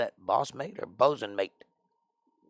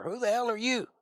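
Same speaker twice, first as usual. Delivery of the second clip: much higher, put-on challenge; acted challenge; 10 semitones higher than usual. Hailing an unknown boat, high mock challenge.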